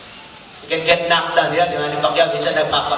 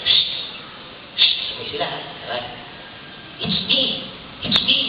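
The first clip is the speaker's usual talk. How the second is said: wheedling former lover; 2 semitones higher, 4 dB quieter than usual. Retold or imitated. Former lover pleading from behind for him to turn.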